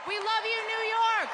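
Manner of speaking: loud rally shout; shouting to the crowd. Real, not acted. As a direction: call it out to the whole arena.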